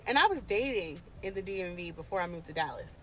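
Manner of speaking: ordinary talk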